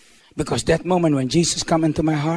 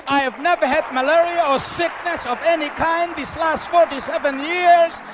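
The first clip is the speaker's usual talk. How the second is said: preaching at full force; 11 semitones higher than usual. Acted. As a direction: preach it to the whole crowd.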